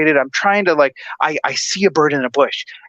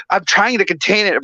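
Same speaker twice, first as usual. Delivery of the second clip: usual speaking voice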